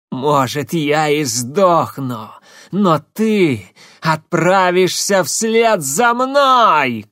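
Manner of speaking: dying witch shouting, choking on blood; bloody mouth shout. Acted. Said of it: "крикнула она". (крик захлёбываясь кровью)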